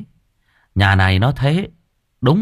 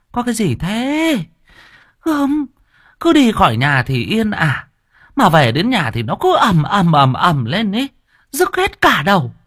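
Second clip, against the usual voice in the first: much higher, shrill-voiced grumbling wife; nagging wife; 11 semitones higher than usual. A narrator's reading. Wife grumbling at her noisy husband. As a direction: complain like an annoyed old wife.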